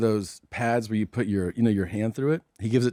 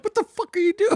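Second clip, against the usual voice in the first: laughing mock quote. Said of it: "he'd be like".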